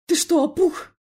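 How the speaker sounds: loud, high outraged shout; barked. A narrator's reading. Felix barking at his brother, outraged. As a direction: bark it in disbelief. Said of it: "гаркнул Феликс".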